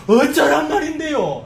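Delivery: high, slow, drawn-out exasperated cry; annoyed and exasperated. Acted. Preacher voicing people who do not know what to do, exasperated. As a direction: lament it in frustration. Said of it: "짜증도 나고 탄식이 나가지고".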